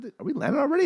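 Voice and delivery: high, confused question; just woke up, baffled; mild mock surprise; groggy surprise. Waking up confused on plane.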